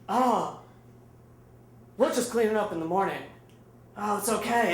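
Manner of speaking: lightly voiced stoner, unbothered; a hint of laid-back stoner, pausing between phrases; total stoner. Storyteller plays Alex taking the news, mild stoner voice. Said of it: "like a total stoner".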